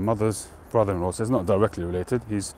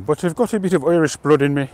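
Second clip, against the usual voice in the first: put-on higher, louder playful Irish accent; mock Irish accent; 8 semitones higher, 8 dB louder than usual. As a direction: tack on "so I have" in a hammed-up Irish accent. Talking about having Irish blood.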